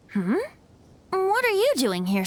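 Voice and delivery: ordinary delivery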